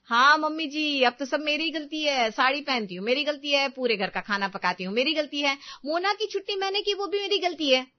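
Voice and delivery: high, indignant mock confession; aggrieved daughter-in-law arguing back; sarcastic outburst. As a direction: pile up the sarcastic self-blame, building to a high peak. Daughter-in-law's sarcastic outburst in quarrel.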